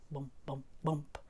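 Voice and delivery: soft, low thudding bumps; bumping sounds. A narrator's reading. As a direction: bounce each bump low and separate. Sound of truck bumping into mud.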